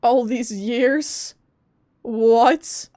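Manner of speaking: ordinary talk, nothing marked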